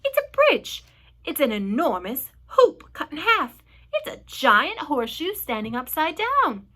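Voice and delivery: animated, amazed characters piping up; excited guessing. A narrator's reading. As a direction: call out each guess eagerly. Creatures shout guesses at the sight.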